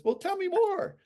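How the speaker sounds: high, curious voice of a willing buyer; interested customer. Retold or imitated. Customer finally opening up to the salesman, curiously. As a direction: lift it into a curious invitation.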